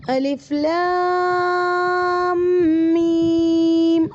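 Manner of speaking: الف لام میم drawn out slowly in one breath; slow, joined Quranic letters; joined together. Shown as a demonstration. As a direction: read الف لام میم as one connected word, no break. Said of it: "آپ نے ایک ساتھ ملا کر پڑھنا ہے".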